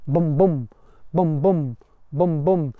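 bum bum, steady heartbeat beat; heartbeat rhythm. Shown as a demonstration. Teacher beating out iambic rhythm, bum, bum, low and steady. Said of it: "It just means a heartbeat".

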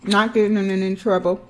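chewing while talking; eating finger food; mouth partly full. Real, not acted. Seen 3: Eats finger food throughout; lips move as if chewing between phrases.

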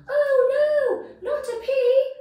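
high loud horrified cry; gasping in horror. Acted. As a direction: shriek in dread. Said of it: "everyone gasped".

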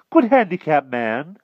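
high, loud bumbling knight; blustering old knight. Acted. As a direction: bluster like a dotty old king. Pellinore voice.

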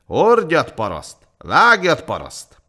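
loud high shout, dropping on the last word; driving shouts. A narrator's reading. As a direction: drive the peasants on with sharp, loud orders. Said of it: "pálcával biztatták a szegény népet".

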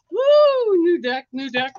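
sharp gasp, then a high whoop; gasp, then cheer out loud. Real, not acted. Host gasps at her new deck, then whoops high.

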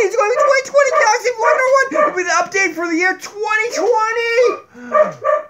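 excited announcement. Acted. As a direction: pump up the big update. Hyped vlog announcement.

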